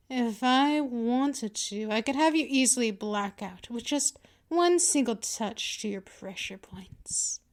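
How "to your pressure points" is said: soft menacing whisper. Acted. Mistress whispering a threat to her pinned pet. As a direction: let the threat slip out in a whisper.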